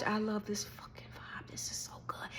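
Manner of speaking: soft awed whisper; hushed excited whisper about the vibe. Retold or imitated. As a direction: breathe it out in delight. Whispered delight at concert.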